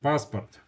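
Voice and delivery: "passport" said the Russian way, PAHS-part; "passport" as Russian "PAHS-purt", tapped r; Russian pronunciation. Shown as a demonstration. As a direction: stress the first A of "passport", unstressed O as a short A. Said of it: "it's pronounced A".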